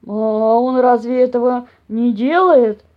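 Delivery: loud, slow, puzzled bear question; surprised bear. A narrator's reading. Papa bear asking about the fox, surprised. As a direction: wonder aloud ponderously. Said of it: "снова удивился папаша Бамсе".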